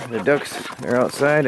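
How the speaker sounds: usual speaking voice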